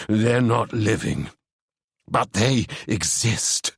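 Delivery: slow, ominous voice; slow it down, let it chill; ominous Ewan. Acted. Ewan warning Seb, slow and ominous.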